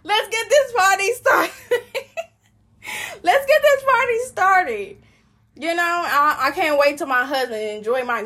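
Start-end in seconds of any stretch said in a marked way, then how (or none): 0.0-5.0 high, loud, laughing "let's get this party started"; laughing party cheer. Retold or imitated. Cheering herself on after heartbreak, laughing high. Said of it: "And then I was like".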